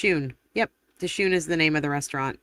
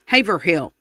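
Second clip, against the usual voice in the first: louder Southern Haverhill, HAY-ver-HILL; Southern accent; 2 semitones higher, 6 dB louder than usual. Shown as a demonstration. Showing viewers the Southern way, Haverhill as HAY-ver-hill in a Southern accent. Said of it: "say it with a Southern accent".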